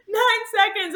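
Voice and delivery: high, loud anguished cry; anguished wail. Retold or imitated. Runner who missed the cutoff, high anguished wail. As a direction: wail out the loss. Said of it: "And I was like".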